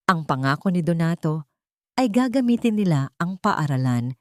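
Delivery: plain reading voice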